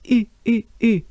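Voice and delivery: "U" through a small, droopy mouth; French U. Shown as a demonstration. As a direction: repeat "U" as isolated French vowels. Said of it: "to pronounce the sound U".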